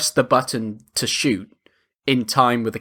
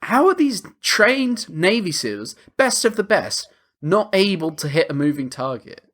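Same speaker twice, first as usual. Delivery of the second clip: exasperated disbelief; 7 semitones higher than usual. Retold or imitated. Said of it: "And I was like".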